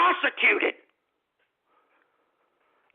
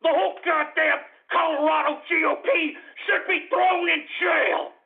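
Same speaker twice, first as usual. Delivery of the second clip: higher, heated demand; angry rant; 5 semitones higher than usual. Real, not acted. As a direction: rail at them in outrage. Ranting that party be jailed.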